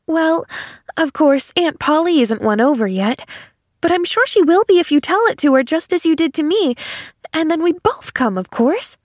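put-on high, eager child's voice; confident little girl. A narrator's reading. Girl sure Aunt Polly will be won over, high and eager. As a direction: chatter hopefully as a little girl.

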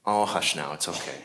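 soft, soothing "hush now," fading almost to nothing. Shown as a demonstration. Preacher showing the gentle misreading, soft and soothing. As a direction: hush it like calming a child. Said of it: "instead of".

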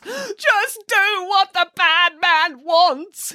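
hammed-up high, drawn-out wail; sobbing plea. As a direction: beg slowly through theatrical sobs. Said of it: "he pleaded in between racking sobs".

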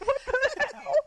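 high, loud put-on what the hell; hammed-up baffled squeal; mock disbelief. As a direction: act stunned at the drunk brother. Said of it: "It's like".

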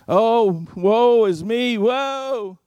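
high, slow hammed-up wail; mock wailing mourner. Mimicking wailing paid mourners.

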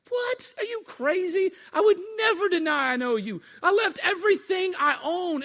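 high-pitched, incredulous outburst; indignant protest. Acted. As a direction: protest as if deeply insulted, voice shooting up. Peter protests predicted denial.

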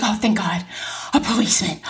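breathy whispered relief; hushed thank-God relief; relieved whisper. Retold or imitated. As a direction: whisper it like a prayer answered. Relieved at seeing the cop.

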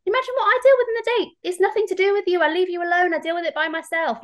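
wife scolding husband, heated and fast; exasperated retort. Retold or imitated. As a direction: let him have it, voice rising. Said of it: "Like I said to him".

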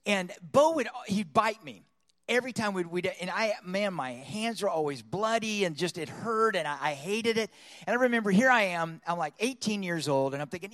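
usual speaking voice

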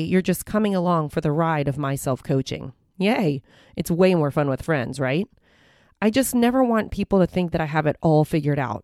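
normal talking voice

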